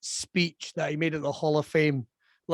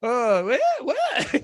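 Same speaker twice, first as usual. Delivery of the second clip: high, scattered mock crowd yelps; a crowd with no idea how to react; confused crowd noise; much slower than usual. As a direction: yelp like a baffled crowd. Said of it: "I've never seen the crowd go".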